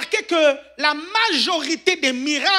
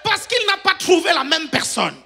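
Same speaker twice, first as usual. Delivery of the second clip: preaching shout; 7 semitones higher than usual. Acted. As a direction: lift it to a shouted climax. Climax of a preaching point.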